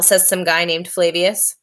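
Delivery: usual speaking voice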